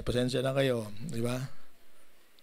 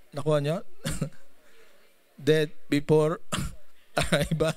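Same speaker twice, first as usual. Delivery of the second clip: ordinary talk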